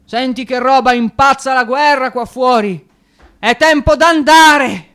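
delirious cries rising to a shout; frantic shouting. Acted. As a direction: drive him out the door at the top of your voice. Raving, urging friend away.

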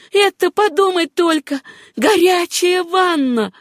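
high, marvelling exclamation; high-pitched rapture, stretched at the end; overjoyed cry. A narrator's reading. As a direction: savour it in tearful delight. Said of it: "крикнула она, и слёзы брызнули".

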